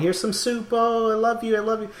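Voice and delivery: high, sweet, gentle mom voice; pleasant easygoing mom. Retold or imitated. Ad mom gently serving soup to her child. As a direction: coo lovingly over the soup. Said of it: "this pleasant, peace, easygoing".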